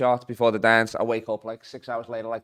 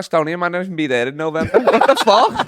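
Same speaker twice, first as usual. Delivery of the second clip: high, breaking into laughter at the shock; cracking up at the awful thought; laughing in disbelief; 7 semitones higher than usual. Real, not acted. Realising his gig may vanish to war, laughing hard.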